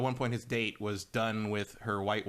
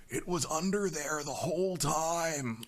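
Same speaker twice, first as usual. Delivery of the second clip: slow, higher, wide-eyed amazement; Nicolas Cage; 4 semitones higher, slower than usual. Retold or imitated. Cage calling out at a magic trick: slow, higher amazed voice. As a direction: stretch it like a delighted drunk fan.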